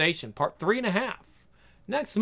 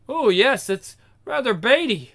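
ordinary talk, nothing marked

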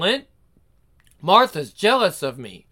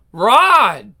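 loud scream of his name; girl screams as boyfriend dives; frightened scream; 8 dB louder than usual. Acted. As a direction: cry out as he plunges. He dives off the ledge.

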